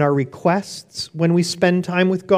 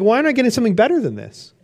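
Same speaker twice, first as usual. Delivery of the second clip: higher, fast complaining; frustrated whine; 7 semitones higher, much faster than usual. Retold or imitated. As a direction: whine like a let-down child.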